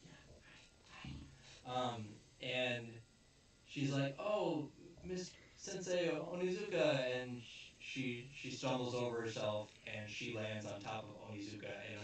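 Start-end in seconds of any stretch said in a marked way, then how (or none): 4.2-7.0 lightly voiced sweet girl. Girl greets teacher.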